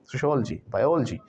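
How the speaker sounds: सोशियोलॉजी as soshol-ji, बायोलॉजी as bayol-ji; correct syllable breaks. Shown as a demonstration. Teacher recaps सोशियोलॉजी, बायोलॉजी as soshol-ji, bayol-ji. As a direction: close both सोशियोलॉजी and बायोलॉजी on L before ji. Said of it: "ऐसे बोलते हैं".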